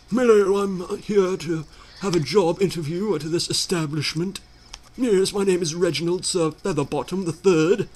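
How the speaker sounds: a mock upper-class English accent, lightly put on; posh voice. Overdressed job applicant greets the store, in a mock posh English accent. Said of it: "I could go in there all posh".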